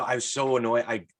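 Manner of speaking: ordinary delivery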